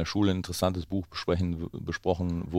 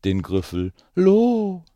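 much higher, slow eerie monster name; spooky drawn-out name; 13 semitones higher, 9 dB louder, much slower than usual. Acted. Reading the scary monster's name to children, spooky and slow. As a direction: stretch the name out to scare.

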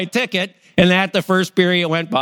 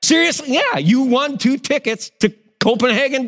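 hockey staff hyping the lucky winner; excited announcer; 7 semitones higher than usual. Retold or imitated. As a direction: proclaim the prize with glee. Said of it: "the guy's like".

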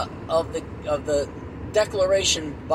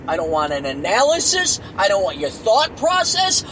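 higher, louder, swearing rant; furious rant; 8 semitones higher, 4 dB louder than usual. Acted. Exasperated adult demanding red or blue, yelling. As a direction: demand the answer in a rage. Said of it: "Fucking H Christ".

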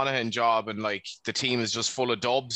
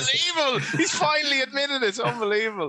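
mock disbelief; 13 semitones higher than usual. Real, not acted. Ribbing co-host's lost accent.

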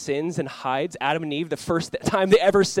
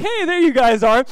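a bright, much higher and louder cheery greeting; cheery wife; 9 semitones higher, 9 dB louder than usual. Retold or imitated. Wife walking in on husband and baby, cheery. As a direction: chirp it with a big smile. Wife voiced, big smile.

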